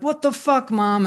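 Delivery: put-on defiant teen yell. Cousins cussing back at their mom, mock defiant yell. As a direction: fling it at her like a rebellious kid. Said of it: "my cousins would be like".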